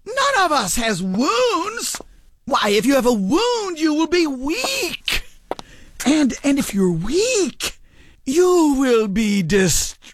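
high-pitched, insistent protest; agitated denial. Acted. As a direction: deny it frantically, voice jumping up. Old woman denies having a wound.

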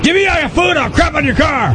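seagull yelling. Retold or imitated.

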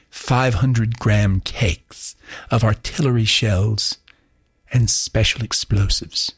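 put-on slow, grand English accent. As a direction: read it like a Shakespearean sonnet, English accent. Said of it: "maybe drag an English accent into this thing".

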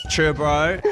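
Chur, bro, with a laugh. Shown as a demonstration. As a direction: giggle through Chur, bro.

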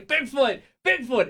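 hammed-up calling out; mock shouting. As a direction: shout it into the woods. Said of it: "out there shouting".

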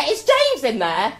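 high, loud, slow sing-song call again; hammed-up calling through the door. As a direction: lean round the door and call out. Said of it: "and go".

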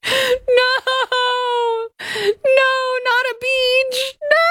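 very high, wailing mock sob; mock crying. Reading the complaint, mock sobbing plaintiff's protest. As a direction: fake a whiny crying plea. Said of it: "began to cry".

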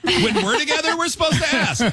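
high, laughing mock outrage; laughing disbelief. Real, not acted. Host laughing at the idea of asking permission, high and amused. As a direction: laugh through the question in disbelief. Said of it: "I have never once thought".

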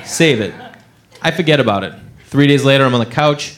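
ordinary talk

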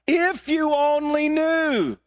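high, slow, drawn-out call; emphatic proclamation. Acted. Jesus replies to the sarcastic woman, high emphatic cry. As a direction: cry it out high, stretching each word.

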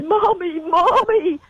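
high-pitched imitation of a boy crying for mommy; high mock cry on reunion; distraught teenage son. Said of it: "he rushed into my arms and said".

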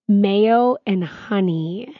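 usual speaking voice

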